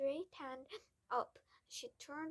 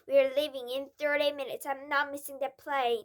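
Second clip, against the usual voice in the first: loud angry father; 3 semitones higher, 15 dB louder than usual. Acted. Father still shouting.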